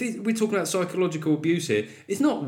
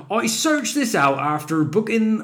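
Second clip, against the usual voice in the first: put-on slow, higher West Country accent; 8 semitones higher, slower than usual. Reading a Devon fan's review in a mock West Country accent. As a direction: drawl it out in a broad rural English accent. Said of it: "So this, this is an easy accent".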